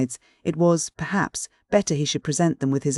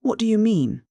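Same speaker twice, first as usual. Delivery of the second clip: higher, sharp angry demand; 7 semitones higher than usual. A narrator's reading. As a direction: demand it, stung. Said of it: "angered him again".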